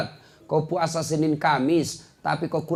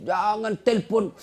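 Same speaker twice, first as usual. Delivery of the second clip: ordinary delivery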